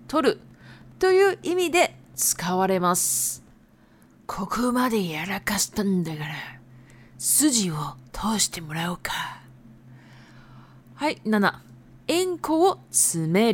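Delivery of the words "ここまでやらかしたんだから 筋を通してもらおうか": hushed menacing gangster whisper. Acted. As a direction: lean in and threaten quietly. Yakuza example line read out.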